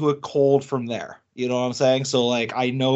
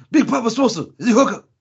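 hype voice; 7 semitones higher, 4 dB louder, faster than usual. Pretend. Hypes co-host as hookup.

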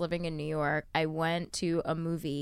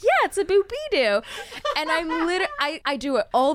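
laughing; 9 semitones higher, 5 dB louder than usual. Retold or imitated. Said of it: "I'm just like".

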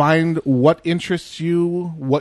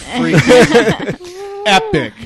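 slow, loud and high, laughing through it; laughing boast; 8 semitones higher, 8 dB louder, much slower than usual. Real, not acted. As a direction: drag out the brag while cracking up. Boasting about last night's show.

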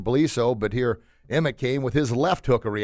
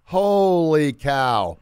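slow, loud amazed; 4 semitones higher, 5 dB louder, much slower than usual. Real, not acted. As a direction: draw it out in amazement. Amazed at the boy.

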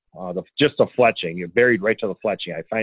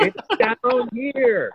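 high, slow, drawn-out call from far away; distant yell; 13 semitones higher, much slower than usual. Retold or imitated. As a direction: call it out long across the mountain. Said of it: "waving his arms over his head going".

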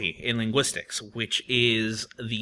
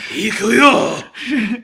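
high, loud anime battle cry; fighting game shout; 8 semitones higher, 8 dB louder than usual. Retold or imitated.

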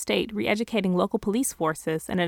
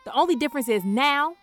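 angry mom; 3 semitones higher, 5 dB louder than usual. Acted. Fed-up mom yelling on phone.

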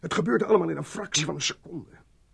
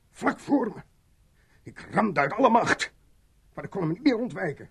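"Ik kon hem niet meer ontwijken" pushed out, higher and louder, with "meer" stressed; distressed reliving; 7 semitones higher, 7 dB louder than usual. Acted. Mitchell relives hitting the man, rising panic. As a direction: panic rising as the memory returns.

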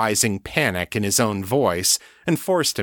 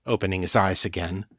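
ordinary delivery

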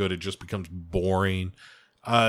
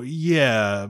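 ordinary delivery

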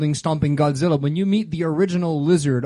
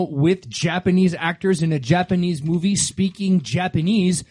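usual speaking voice

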